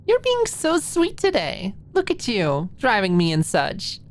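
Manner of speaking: put-on high, sweet teasing girl voice; Maud's high girly voice.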